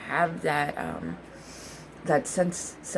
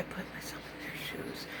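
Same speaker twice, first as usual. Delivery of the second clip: whisper; 15 dB quieter than usual. Real, not acted. Soft reflective aside.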